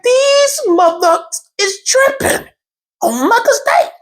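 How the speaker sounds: high, drawn-out mock outrage; hammed-up heated rant. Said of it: "Oh, I'm getting heated".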